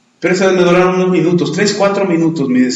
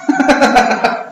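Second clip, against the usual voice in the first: laugh; 8 semitones higher than usual. Real, not acted. Preacher laughs at own story.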